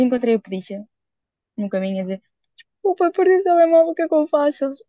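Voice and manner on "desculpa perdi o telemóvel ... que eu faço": high, louder, panicky ask; mock-panicked question about the lost phone; panicked plea. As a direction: replay the helpless rush to the police. Asking police in panic.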